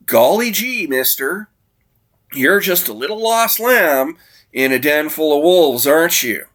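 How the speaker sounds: high, chipper golly-gee voice; bright aw-shucks kid; wholesome 1950s kid. Retold or imitated. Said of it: "Piper's sister Nat says to the sole survivor".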